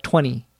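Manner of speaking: twenty said as "twenny"; dropped T. Shown as a demonstration. Teacher repeating the fast-speech example, dropped T in twenty. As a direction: leave out the T after the N in twenty. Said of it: "you pronounce it T W E N".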